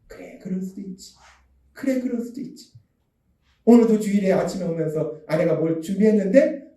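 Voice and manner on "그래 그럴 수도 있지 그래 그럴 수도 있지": soft, understanding murmur; gentle acceptance. Acted. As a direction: say it softly, as if forgiving. Models the forgiving phrase softly. (조용히 너그럽게 받아 주는 말투)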